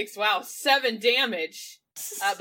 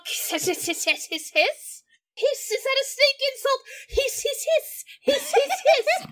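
high-pitched repeated spoken hisses; mock a snake by saying hiss in a high silly voice; mock snake talk. Spellcaster insults a snake in its language, high mock hissing. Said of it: "How do snakes talk?".

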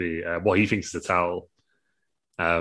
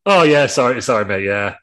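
blurted apology; 3 semitones higher, 9 dB louder than usual. Acted. Cheeks owning up to Randy, loud blurted apology. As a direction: burst out with a breezy sorry.

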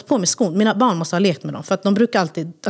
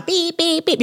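higher imitated beeping; alarm beeps; 8 semitones higher, slower than usual. She imitates the lift alarm, higher beeping. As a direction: mimic the alarm button. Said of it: "den bara".

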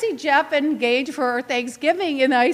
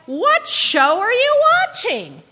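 slow, louder, higher disbelieving question; incredulous retort; 7 semitones higher, 5 dB louder, slower than usual. Retold or imitated. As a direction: drag it out like you can't believe it. Reacting to social media haters.

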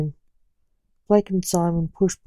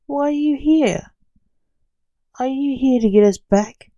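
higher-pitched, incredulous questions; urgent surprised questioning; 7 semitones higher, 4 dB louder than usual. A narrator's reading. Blake to the man waiting beyond the portal, urgent surprise. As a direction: press for answers in surprise.

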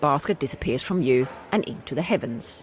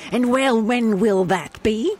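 slower, higher Scottish wife; 5 semitones higher, 3 dB louder, slower than usual. Acted. A Scottish wife asks her husband when he'll arrive, slow and pointed. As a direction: press him on when he'll be home, Scottish. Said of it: "can I do a Scottish?".

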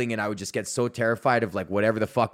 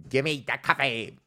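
hyper kid imitation. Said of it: "these little fucking hyperactive".

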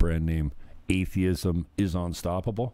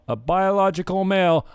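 louder, punched rant line; emphatic; 10 semitones higher, 5 dB louder than usual. Real, not acted. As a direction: hammer the word male. Ranting at the clip.

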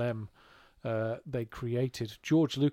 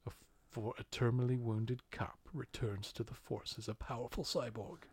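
trailer voice; 2 semitones lower, 9 dB quieter than usual. Pretend. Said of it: "You're getting deeper into it".

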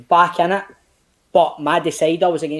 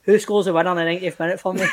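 ordinary delivery

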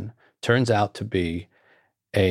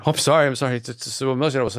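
higher, mock-tearful apology; 4 semitones higher, faster than usual. Woman at the book meeting apologizing, imitated tearful voice. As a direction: imitate someone apologizing through tears. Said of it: "she just burst into tears".

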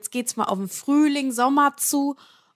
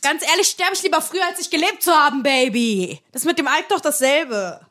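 defiant joke; 4 semitones higher, 5 dB louder than usual. Acted. Defiant comic reply about smoking.

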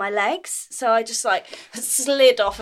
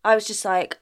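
normal talking voice

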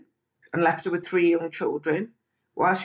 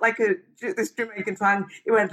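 ordinary delivery

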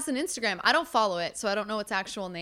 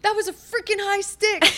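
high-pitched imitation of an irate player; mock mad yelling; 9 semitones higher, 4 dB louder than usual. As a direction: play someone protesting to the ref. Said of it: "somebody really mad yelling at a ref".

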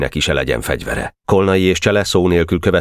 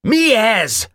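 roared. A narrator's reading. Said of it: "ordított Boka".

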